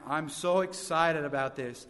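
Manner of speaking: lightly imitated African accent; a hint of an East African accent. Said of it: "he said in his African accent".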